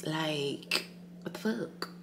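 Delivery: hushed. Real, not acted. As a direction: murmur it in disbelief. Quiet bedside muttering.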